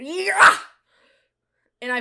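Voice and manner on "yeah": triumphant yell. Retold or imitated. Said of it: "I went".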